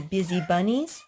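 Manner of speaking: ordinary delivery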